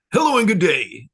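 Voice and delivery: hint of Bashar's stiff formal voice; imitating Bashar. Imitating the channeler's odd greeting, lightly formal. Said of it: "that's how he sounds".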